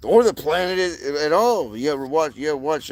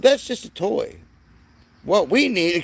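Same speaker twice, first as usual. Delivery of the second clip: quoting her, higher; 5 semitones higher than usual. Retold or imitated. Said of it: "She said".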